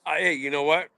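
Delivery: usual speaking voice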